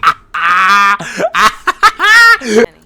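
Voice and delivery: long, loud cackling laugh. Real, not acted. Viewer reacting to the candidate's rant, loud laugh. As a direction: burst into prolonged loud laughter.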